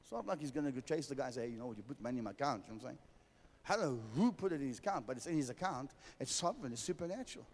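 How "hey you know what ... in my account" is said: quick aside. Pretend. Imagined quote chasing donor.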